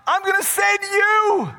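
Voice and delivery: high, loud, slow summons; voicing God. Preacher voicing God calling Moses again, slow and high. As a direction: call out slowly like God. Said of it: "look at what he says".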